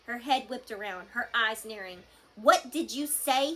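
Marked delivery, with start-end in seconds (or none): 2.4-3.5 louder, slow, dangerous question; menacing hiss. A narrator's reading. Fireworm turns on Toothless with a threat. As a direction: dare him to repeat it. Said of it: "hissed Fireworm".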